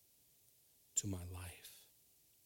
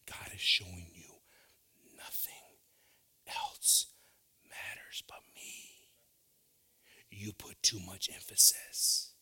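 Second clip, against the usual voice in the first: whispered voice of God; 2 semitones lower, slower than usual. Acted.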